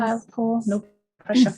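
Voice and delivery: loud playful jab at the panel; teasing. Acted. Host teasing Kyle and Paul on the panel, loud playful jab. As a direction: tease them loudly, half laughing.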